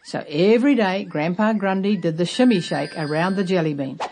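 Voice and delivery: ordinary delivery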